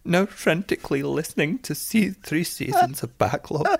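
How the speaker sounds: amateur put-on Scottish voice; Scottish accent. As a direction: read it in a hammed-up Scottish lilt. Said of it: "should I do it in a Scottish accent?".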